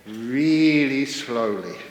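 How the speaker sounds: slow-motion emphasis in a comic tale; drawn out slowly. Acted. Storyteller describing the meditator's walk to the audience, loudly drawn out. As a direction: stretch each word out, loud.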